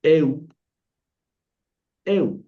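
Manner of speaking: eu as eh-oo with a Spanish U; correct diphthong. Shown as a demonstration. Teacher giving the correct sound, eu ending in Spanish U. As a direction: finish eu firmly on a plain oo. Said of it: "Entonces aquí el sonido es au".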